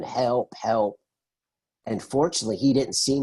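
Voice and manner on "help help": ordinary delivery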